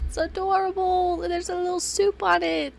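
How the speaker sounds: high-pitched squeal of delight; gushing coo. Real, not acted. Player melting over a sweet note, high gushing coo.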